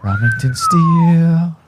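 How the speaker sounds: high, slow, drawn-out announcer voice; movie trailer announcer. Pretend. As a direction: announce the name like a big movie title. Dramatic name announcement.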